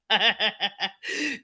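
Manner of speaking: a rising, touched laugh; real laugh, close to tears; happy laugh. Real, not acted. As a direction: laugh as the emotion catches you. Moved by a viewer's comment.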